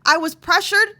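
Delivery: high and loud, voicing the victim's protest; 1 loud pleading protest. Voicing a pressured victim to the listeners, loud and high. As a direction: cry out each claim as the wronged girl.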